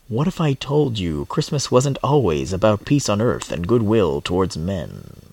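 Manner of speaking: deep, slow Morpheus impression; low, slow voice with weighty pauses; Laurence Fishburne. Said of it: "I nailed that Lawrence Fishburne".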